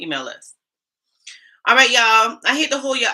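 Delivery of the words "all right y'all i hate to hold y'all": loud hello. Real, not acted. Hailing chat viewers excitedly.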